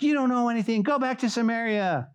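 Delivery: high-pitched heckling retort; mock yelling back. Builders to their taunters, mock yell. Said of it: "we wanna yell back".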